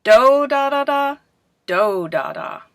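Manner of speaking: "Do, da, da, da" held high and loud, last "Do, da, da" falling; intonation in nonsense syllables. Shown as a demonstration. Teacher has class guess the phrase, exaggerating its stress in "Do, da". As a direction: belt the first "Do, da" run up high, then drop. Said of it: "for the stress and the intonation".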